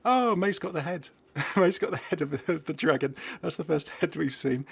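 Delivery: light up at the find; sudden delight; excited discovery. Real, not acted. Delighted at finding the dragon head.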